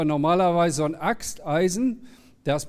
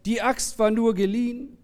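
raised-pitch storyteller's lament; lightly dramatized cry, higher than usual; mild outcry; 5 semitones higher than usual. A narrator's reading. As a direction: call it out in acted dismay. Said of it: "schrie er".

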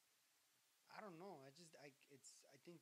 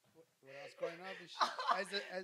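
giggling through the words; holding back laughter; stifled giggles; 9 dB louder than usual. Real, not acted. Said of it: "I'm trying not to laugh on the mic".

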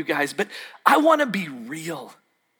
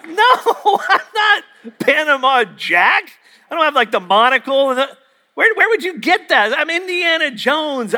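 higher-pitched, exasperated protest; indignant retort; 7 semitones higher, 3 dB louder than usual. Retold or imitated. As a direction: act out comic disbelief at being mistaken. Indignant retort retold at party.

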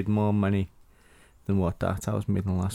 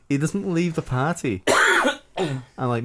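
ordinary talk

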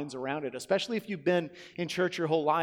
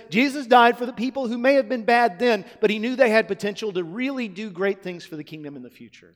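higher, louder, sing-song mock reading; mock wrong version; 5 semitones higher, 5 dB louder than usual. Shown as a demonstration. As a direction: parody the watered-down version. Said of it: "that's actually not what it says".

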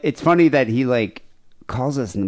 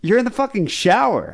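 incredulous; 11 semitones higher than usual. Retold or imitated. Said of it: "And I'm like".

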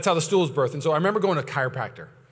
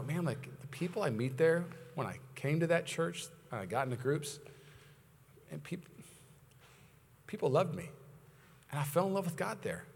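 much softer, reflective quote; wistful recollection; 9 dB quieter than usual. Acted. Member looks back on joining the church, hushed and wistful. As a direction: recall it quietly, with tender nostalgia.

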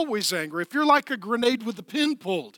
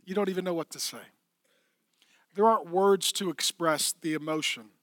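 usual speaking voice

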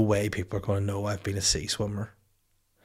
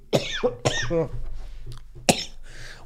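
put-on old man cough. Imitating aged swimmer.

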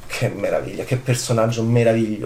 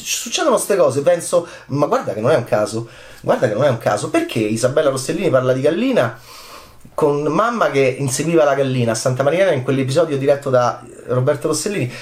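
ordinary talk

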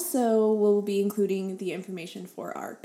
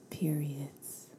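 whispered; 6 semitones lower, 10 dB quieter than usual. Pretend. Listing podcast topics in whispers.

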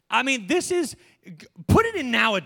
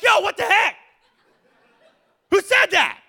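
much higher and louder, freaked out; startled outburst; 11 semitones higher, 8 dB louder than usual. Retold or imitated. Pastor acting shocked at a talking bush, startled yelp. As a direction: yelp it like a voice just spoke from nowhere. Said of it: "I'm like".

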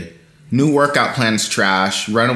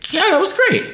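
excited; much faster than usual. Real, not acted. Praising the 3D model video.

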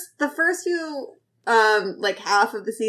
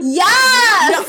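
high, loud yes; excited shout; 8 semitones higher, 6 dB louder than usual. Real, not acted. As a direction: shout it out gladly.

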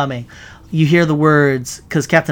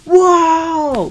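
high, amazed whoa; audience gasp; 14 semitones higher, 4 dB louder than usual. Retold or imitated. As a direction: gasp in awe like a crowd. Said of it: "everybody in the audience went".

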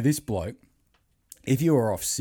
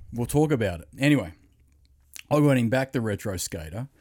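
usual speaking voice

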